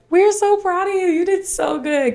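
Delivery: high, gushing praise as her friends. Retold or imitated. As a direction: coo it like proud friends cheering her on.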